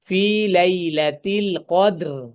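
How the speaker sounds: very slow ফি লাইলাতিল কদর, ending on a silent র; stopping demo. Shown as a demonstration. Teacher showing how to stop on the র, slow recitation. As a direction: draw it out and stop on কদর with sukun. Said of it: "তাহলে কিভাবে?".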